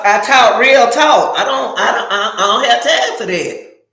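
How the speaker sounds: ordinary delivery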